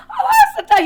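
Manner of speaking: very high, loud laughing cry; laughing shriek. Retold or imitated. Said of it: "I'm like".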